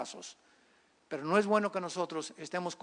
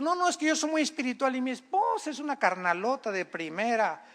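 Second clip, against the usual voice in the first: imitating a sanctimonious spouse, pitched up; higher, hammed-up holier-than-thou excuse; mock self-righteous; 4 semitones higher than usual. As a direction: protest piously, as a caricature.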